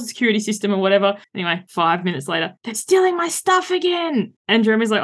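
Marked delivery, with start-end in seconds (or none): 2.6-4.3 put-on very high, drawn-out kid wail; put-on child's voice of mock outrage; seven-year-old son. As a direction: imitate a little boy complaining, slow and high. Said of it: "he comes over and he's like".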